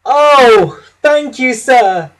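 high, loud delighted thanks; proud thanks. A narrator's reading. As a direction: burst with pride as you thank him. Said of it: "feeling very proud".

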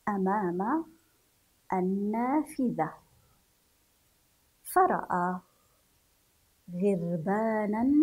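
put-on slow, carefully paced reading to young pupils; story reading for children. Teacher reading a story to pupils, slow and clear. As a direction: read each word out, pausing between them.

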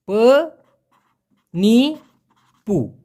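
পনিপু drawn out very slowly; stretched model word. Shown as a demonstration. Teacher drilling Malay word, very slow. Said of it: "drawn-out model word".

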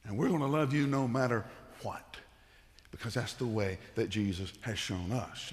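usual speaking voice

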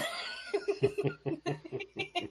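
high burst of laughter; cracking up at the punchline. Real, not acted. Teller laughs at own story.

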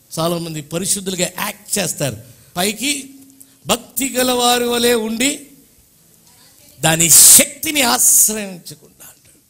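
ordinary talk, nothing marked

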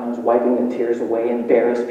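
usual speaking voice

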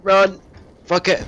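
high, loud burst of alarm; panicked yell. Real, not acted. Gamer fleeing a lock-on, panicked yell. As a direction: shout as the missile locks on.

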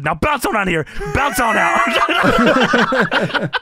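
high wrestler impression, building to a drawn-out holler; Booker T. Wrestler dismissing the interviewer, high hammed-up holler. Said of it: "And they both look at her and he says".

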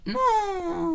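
very high mock crying no; hammed-up despair; mock wail. Loses his mushrooms.

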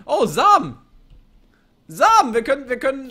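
high and loud, delighted; excited. Real, not acted. Player finding seeds, high delighted exclamation. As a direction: burst out with a new find.

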